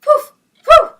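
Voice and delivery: high, loud yappy woof; puppy barking. Acted. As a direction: yap like a noisy puppy. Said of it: "He barked at the birds".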